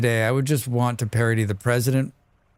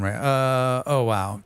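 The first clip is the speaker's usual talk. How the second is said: ordinary talk, nothing marked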